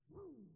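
high, loud vroom; engine vroom. Retold or imitated. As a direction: rev the heart like an engine. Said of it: "if my heart ain't".

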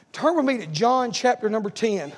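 ordinary delivery

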